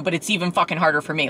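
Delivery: usual speaking voice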